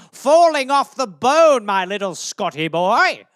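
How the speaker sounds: mock-sinister relish; gloating cannibal. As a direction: savour the words like a ghoul planning dinner. Gloating cannibal soccer parent, acted.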